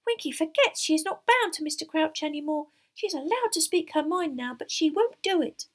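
high, squeaky little elf; high-pitched house-elf voice; confiding squeak. A narrator's reading. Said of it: "squeaked Dobby confidentially".

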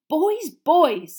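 loud, high, distracted plea; vague admonishing. Father to squabbling sons, distracted admonishing. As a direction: half-attentively call the boys to order. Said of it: "said Mister Weasley vaguely".

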